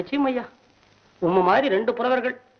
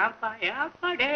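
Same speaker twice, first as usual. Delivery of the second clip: slow sobbing plea; 6 dB quieter, slower than usual. Acted. Sobbing lament alone.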